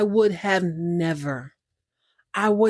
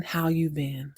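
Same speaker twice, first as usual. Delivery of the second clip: lower, softer retold reply; low echo of the question; second character asking back; 4 semitones lower, 6 dB quieter, slower than usual. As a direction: answer in a low, quiet voice. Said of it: "They ask each other how they've been".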